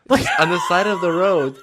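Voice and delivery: high, loud laughing exclamation; laughing shout. Real, not acted. Answering a friend's joke, a loud laughing outburst. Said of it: "This all happens at McDonald's?".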